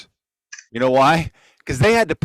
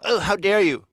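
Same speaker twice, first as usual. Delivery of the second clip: higher, prissy mock outrage; mock offended; 6 semitones higher than usual. A host mocking a realtor who balks: mock outrage. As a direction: play the insulted realtor, hammed-up. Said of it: "If they balk and go".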